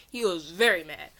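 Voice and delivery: plain reading voice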